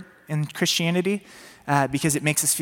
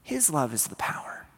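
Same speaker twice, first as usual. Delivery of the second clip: hushed whisper of conviction; whispered truth; 2 semitones lower, 7 dB quieter than usual. Real, not acted. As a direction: let it land in a whisper. Preacher whispers climax.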